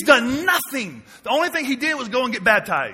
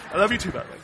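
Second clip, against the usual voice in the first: tender aside; 3 semitones lower, 4 dB quieter, much faster than usual. Real, not acted.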